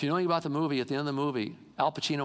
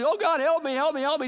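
loud, high-pitched cry for help; mock desperate plea; 12 semitones higher, 5 dB louder than usual. People crying to God when calamity comes, acted desperate pleading. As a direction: beg frantically, as a preacher acts it out.

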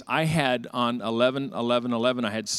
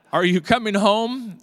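wife's voice; 7 semitones higher, 5 dB louder than usual. Retold or imitated. Wife on phone, retold.

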